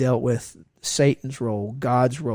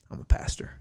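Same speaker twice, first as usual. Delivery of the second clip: soft, near-whispered admission; hushed, careful confession; retold hushed confession; 6 dB quieter than usual. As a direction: admit it under your breath, as if risky. Retold risky confession in cab.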